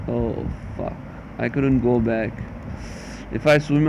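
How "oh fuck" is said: breathy, hushed swear under the breath; whispered curse. Real, not acted. The player mutters at a loss under the breath, whispered. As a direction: let the curse slip out under your breath.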